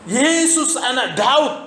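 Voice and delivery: high, slow, drawn-out cry for help. Acted. Woman in the crowd cries out to Jesus, high and drawn out. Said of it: "Dia sudah teriak".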